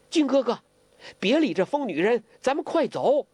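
high, urgent plea to leave; urgent call. Acted. Huang Rong urging Guo Jing off the sinking boat, urgent.

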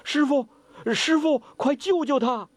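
high, urgent pleading to the master; frantic student calling for help; anxious plea. Acted. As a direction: beg him to save her. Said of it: "郭靖心里头急呀".

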